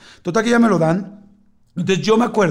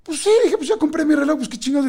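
mock carefree; 9 semitones higher than usual. Resigned to the price, treating himself.

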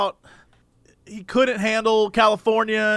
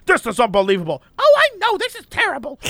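high, whiny mock complaint; mock outraged drivers; 7 semitones higher than usual. Mocking angry drivers stuck at the broken gate, whiny. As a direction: parody the fuming drivers.

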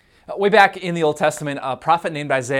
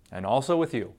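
bored response; 3 dB quieter than usual. Retold or imitated. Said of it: "what do you say back".

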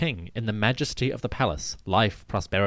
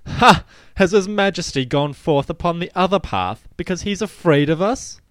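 a much louder, higher jeering taunt; mocking enemies; 7 semitones higher, 5 dB louder than usual. Pharaoh voicing enemies who mock him as afraid, loud jeer. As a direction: jeer at a cowardly king. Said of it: "they will say".

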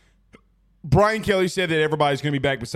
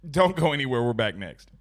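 ordinary delivery

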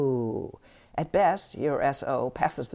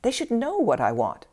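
a bit higher, louder railing complaint; 6 semitones higher, 4 dB louder than usual. Acted. The tired partner rails against the nagging inner voice. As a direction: push "what" hard in indignant protest. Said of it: "you rail".

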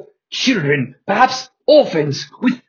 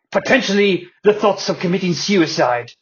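ordinary talk

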